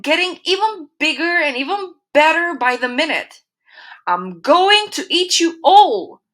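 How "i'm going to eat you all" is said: higher, louder hungry wolf threat; growling wolf. A narrator's reading. Angry wolf threatens the pigs, loud growl. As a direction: snarl it at the pigs. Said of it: "he growled".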